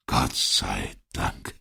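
put-on old man sighing, low and very slow; relieved sigh. A narrator's reading. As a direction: sigh it out slowly, pitched low. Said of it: "seufzte der alte Mann". (erleichtert seufzend, tief und langsam)

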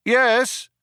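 high calling reply; called back. A narrator's reading. The group answers the cop, called back. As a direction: call it back across a distance. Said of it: "they called back".

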